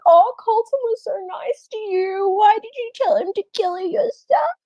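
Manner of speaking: mocking high whiny voice; high-pitched mocking whine. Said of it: "it's like".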